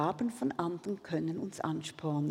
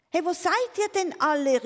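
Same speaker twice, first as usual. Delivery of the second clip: high, loud, quick call; raised, dismayed shout; disappointed calling out; 10 semitones higher, 6 dB louder, faster than usual. A narrator's reading. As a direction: call out for the missing friends, let down. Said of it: "rief er enttäuscht".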